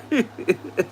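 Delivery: high, light giggle; trailing chuckle after a joke; laughing. Real, not acted. Streamer apologising to Clint through amusement, laughing.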